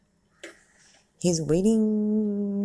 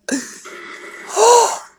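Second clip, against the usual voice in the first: loud shocked gasp; 11 dB louder than usual. Real, not acted. Shock at movie scene.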